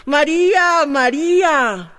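high, loud call down a hole; yelling a name into the earth. Retold or imitated. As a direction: call out to your lost daughter. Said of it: "started yelling".

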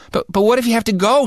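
high, fast, stammering worry; worried boy questioning the teacher; anxious kid. Retold or imitated. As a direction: blurt it out like a panicked kid. Kid protests no bathroom break.

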